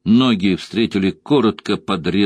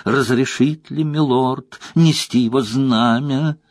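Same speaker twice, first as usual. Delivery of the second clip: higher, slow, mock-grand servility; bow and drip with sarcasm; pompous sarcasm; 5 semitones higher than usual. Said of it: "напыщенным голосом, сочащимся от сарказма".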